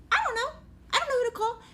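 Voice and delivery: frantic panic. Retold or imitated. Mom frantic over which number to call for the alarm, a high panicked wail. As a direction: voice shooting up in helpless panic.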